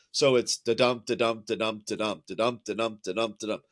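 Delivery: chanting da-dum with a heavy beat on dum; iambic beat. Shown as a demonstration. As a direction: tap out the iambic rhythm da-dum. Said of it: "what we call iambic tetrameter".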